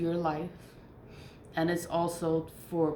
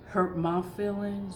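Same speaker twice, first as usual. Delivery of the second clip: ordinary talk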